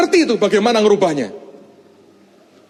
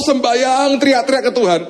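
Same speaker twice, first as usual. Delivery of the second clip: ordinary talk, nothing marked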